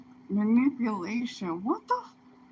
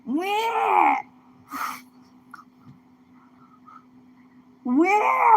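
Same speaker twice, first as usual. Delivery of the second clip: panicked wail; 10 semitones higher than usual. Real, not acted. Scared streamer wailing at the screen in panic. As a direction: let out a startled terrified cry. Panicked screams at something scary.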